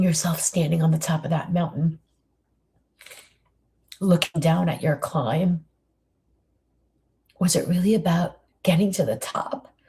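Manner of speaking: walk the listener back down the mountain gently; guided reflection. Acted. Leads a reflective guided visualization.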